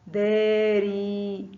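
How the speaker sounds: داري very slowly, ري held long; drawn-out example word. Shown as a demonstration. Teacher showing pupils the long vowel, slow and stretched. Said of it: "ينطق ري".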